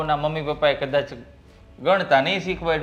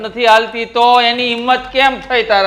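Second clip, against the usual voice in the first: loud, higher-pitched protest; indignant outburst; 4 semitones higher, 7 dB louder than usual. Acted. Husband reacts to wife's complaint.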